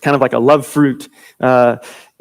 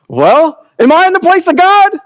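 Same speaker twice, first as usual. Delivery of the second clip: much higher, loud mock-outraged retort; indignant Jacob; 14 semitones higher, 4 dB louder than usual. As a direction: fling the verse back in mock outrage, squeaky high. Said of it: "He's like".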